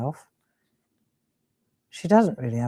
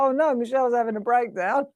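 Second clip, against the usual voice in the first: alarmed; 6 semitones higher, faster than usual. Retold or imitated. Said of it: "I thought".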